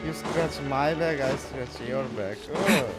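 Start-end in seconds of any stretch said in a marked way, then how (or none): none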